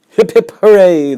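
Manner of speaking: high, loud mock cheer; a sudden bright little cheer; playful cheer. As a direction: toss off a cheery hooray. Reader's playful cheer aside.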